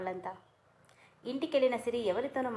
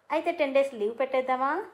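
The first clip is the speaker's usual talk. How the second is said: playful, cheeky question, higher and louder; teasing; 4 semitones higher, 6 dB louder than usual. A narrator's reading. Kiran cheekily asks his wife for leave, teasing. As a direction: tease her with a grin in the voice. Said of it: "కొంటగా అన్నాడు కిరణ్".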